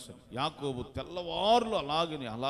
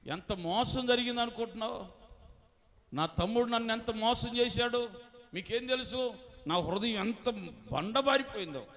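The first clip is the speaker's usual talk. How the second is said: bitter Esau; 7 semitones higher, 4 dB louder than usual. Acted. Esau's bitter complaint voiced.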